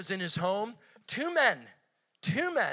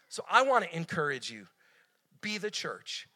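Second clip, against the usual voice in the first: ordinary talk, nothing marked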